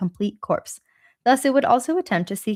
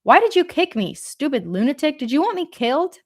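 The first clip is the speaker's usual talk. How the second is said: yelled; 7 semitones higher than usual. A narrator's reading. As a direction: shout at him, furious. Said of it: "and yelled".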